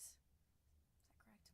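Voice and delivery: soft, breathy whisper of "Is that correct?"; slow whispered "Is that correct?". Real, not acted. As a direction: repeat "Is that correct?" under your breath.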